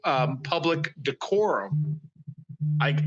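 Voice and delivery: ordinary delivery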